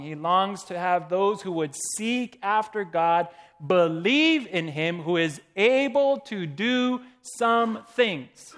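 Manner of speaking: loud, ringing read of a quoted passage; preacher reading scripture aloud with full force; preached reading. Acted. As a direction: proclaim the quotation to the congregation.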